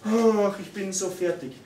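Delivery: long sighed "ach", then worn-out complaint; mock exhausted. Preacher demonstrates negative self-talk to congregation, mock exhausted sigh.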